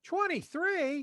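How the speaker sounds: high, slow, surprised; incredulous echo of the number. Real, not acted. Surprised at the count.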